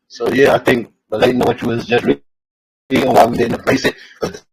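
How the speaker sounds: phone breaking up; garbled, distorted phone line. Real, not acted. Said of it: "your phone's doing that again".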